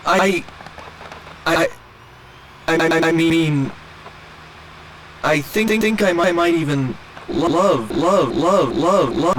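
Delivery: stuck like a skipping recording; glitching stutter. Acted. Android glitching mid confession.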